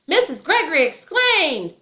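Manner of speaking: the wrong take, all of it yelled; yelling the whole line. Shown as a demonstration. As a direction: shout every word, tag included. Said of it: "It's not".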